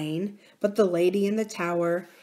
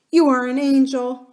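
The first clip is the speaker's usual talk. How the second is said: fond cry; 6 semitones higher, 8 dB louder than usual. A narrator's reading. Nurse embraces her.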